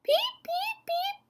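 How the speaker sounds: tiny, very high beeps; peeper call. Acted. Storyteller voicing the peeper frog's tiny high chirp. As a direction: chirp like a little frog.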